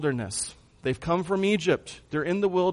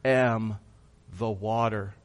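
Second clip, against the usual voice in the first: slow, deliberate declaration with long pauses; audacious declaration; 3 semitones lower, 3 dB quieter, much slower than usual. Retold or imitated. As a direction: declare it boldly, word by word, without shouting. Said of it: "he audaciously declares".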